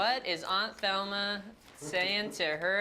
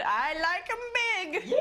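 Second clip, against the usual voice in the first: high, slow granny voice; hammed-up high granny voice; 9 semitones higher, slower than usual. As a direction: drawl it out like a cheeky old granny. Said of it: "Granny said".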